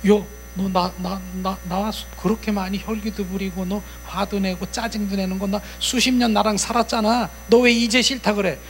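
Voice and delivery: high-pitched, lightly acted complaint; protesting evil spirit. Spirits to their host of decades, protesting complaint. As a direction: argue back as a rejected companion. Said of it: "그러면 얘들이".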